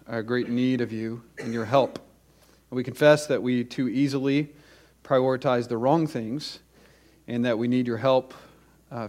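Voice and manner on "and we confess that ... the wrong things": usual speaking voice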